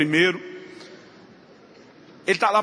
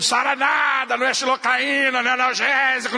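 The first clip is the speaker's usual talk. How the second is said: mock outburst; 6 semitones higher than usual. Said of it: "bradar pra Deus e o mundo".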